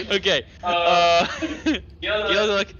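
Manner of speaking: ordinary delivery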